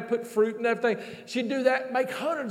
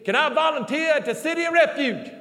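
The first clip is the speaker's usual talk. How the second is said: high-pitched voicing of an eager woman; Vanessa's eager request; 6 semitones higher than usual. Preacher voices Vanessa asking to volunteer, high mock pleading. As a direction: pitch it up and plead, imitating her.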